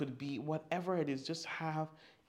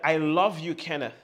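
loud declaration; 8 dB louder than usual. Shown as a demonstration. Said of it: "Can you say that?".

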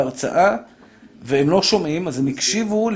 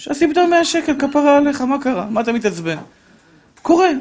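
much higher and louder, pleading; impassioned plea; 9 semitones higher, 4 dB louder than usual. Acted. Preacher defending a daughter who lost money, impassioned. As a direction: cry out for the little girl.

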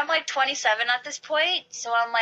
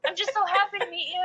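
gushing fan; 10 semitones higher than usual. Retold or imitated. Crying fan gushing to idol.

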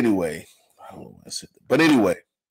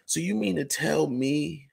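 ordinary delivery

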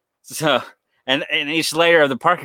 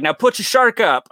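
high-pitched retold cop command; mock police order; 10 semitones higher, 4 dB louder than usual. Cops to dreamer about his shark, mock commanding.

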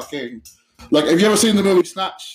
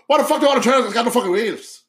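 put-on real fast, thick accent; 6 semitones higher, faster than usual. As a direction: fire it off in a thick, hurried brogue. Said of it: "But he says it real fast".

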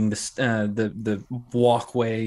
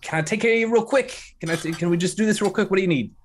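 higher, rushed barkeep; hurried Larry; 5 semitones higher, faster than usual. Acted. Larry pushing the early guests to order fast, rushed. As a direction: hustle them along, nervous and quick. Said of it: "and he says".

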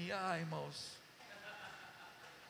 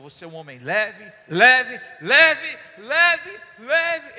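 preaching declaration; 2 semitones higher, 14 dB louder, much slower than usual. Acted. Pastor proclaiming to André that he is a light man, shouting. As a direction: hammer the word "leve" again and again, rising.

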